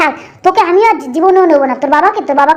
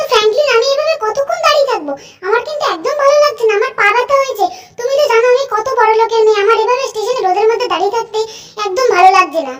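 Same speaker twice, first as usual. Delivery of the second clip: put-on sulky rich girl; 4 semitones higher than usual. Angel Priya complaining to Franklin about waiting in the sun, high and sulky. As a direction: whine like a spoiled rich daughter.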